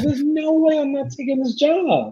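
high-pitched mock-adamant quote; acted insistence. Recalls his own resolve, acted.

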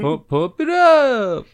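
loud, high, drawn-out "up". Acted. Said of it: "his voice goes higher in the inflection".

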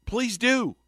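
high, sing-song please do; sweetly loaded please do. Retold or imitated. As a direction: say it sugary but loaded. Repeated, higher.

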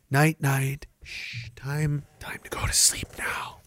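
soft unvoiced bedtime whisper; whispering over a crib. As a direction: lull a baby to sleep. Lulling them to sleep.

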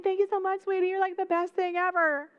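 high, gushing mock sweetness; hammed-up pampered husband cooing his thanks; spoiled brat. Spoiled king thanks his wife for the vineyard, high and gushing. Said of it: "picture him as this spoiled brat".

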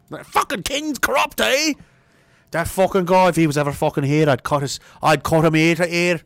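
a hint of a rebellious Scottish uncle; Scottish clansman. Ancestor uncle cursing the king in a light Scottish accent. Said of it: "my ancestors fucked around and found out".

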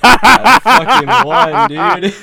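high, loud and slow, laughing through the words; triumphant laughing brag; laughing gloat. Real, not acted. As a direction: crow about the win while cracking up.

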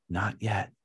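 low, soft refusal; calm master. Acted. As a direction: answer quietly and low, unmoved. Master calmly refuses the pleading clay.

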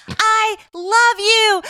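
high, drawn-out stadium shout; retold yell. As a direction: belt it slowly as if calling across a ballpark.

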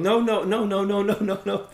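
high, loud string of rapid no's; mock group protest. Imagined movie watchers reacting to the film, mock protesting. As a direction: protest like an alarmed audience. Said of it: "we'd be going".

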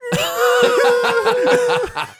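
shrill, loud burst of laughter; high squealing laugh. Real, not acted. Friends retelling childhood scoldings, squealing laugh.

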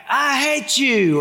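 put-on high, slow kid's outburst; angry kid. Son yelling at his brother, high slow outburst. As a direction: spit it out like a mad little boy.

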